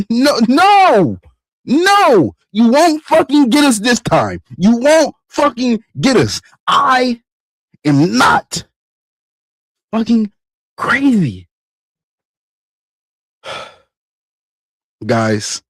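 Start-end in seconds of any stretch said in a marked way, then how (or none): 0.1-11.5 defiant. Real, not acted. Defiant climax of the rant.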